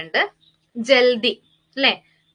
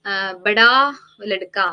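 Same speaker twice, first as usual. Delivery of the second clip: each word drawn out slowly and a little louder; slow example phrase; 6 dB louder, much slower than usual. Shown as a demonstration. Teacher to students, slowly dictating an example. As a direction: spell out the Hindi phrase for the class. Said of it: "അതായത്".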